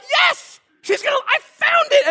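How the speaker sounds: very high, squealed victory cry; mock triumph. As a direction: re-enact a dad's giddy triumph. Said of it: "here's the thing for me. I was like".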